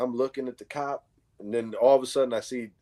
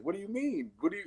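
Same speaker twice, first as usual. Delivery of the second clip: high, softer baffled question; thrown off; 8 semitones higher, 6 dB quieter, faster than usual. Retold or imitated. Kid answers neighbor's check-in, thrown off. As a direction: imitate someone caught off guard. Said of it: "he kinda got put to the side".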